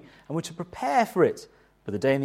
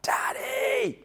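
put-on child screaming Daddy. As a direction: shriek it like a toddler wanting attention. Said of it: "Zephan, who screamed at the top of his voice".

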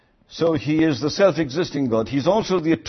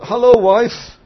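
stiff greeting; 9 semitones higher, 7 dB louder than usual. Shown as a demonstration. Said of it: "She would be very indignant".

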